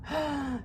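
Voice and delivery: quiet high gasp; teacher's playful gasp; mock gasp. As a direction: gasp to act out the surprise. Quizzing children on the story.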